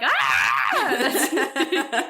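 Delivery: a short laughing shout of "Ah!"; mock loud shout. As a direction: blurt "Ah!" too loud, half laughing. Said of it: "the obnoxious laugh who is talking way too loud".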